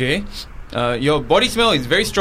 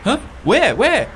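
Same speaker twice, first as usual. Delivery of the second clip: surprised; 8 semitones higher, slower than usual. Acted. Officer turns to look.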